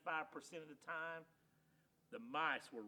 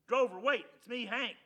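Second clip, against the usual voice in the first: loud, high call after him; 8 semitones higher, 13 dB louder than usual. Acted. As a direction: shout to stop him running off. Calling after fleeing dog.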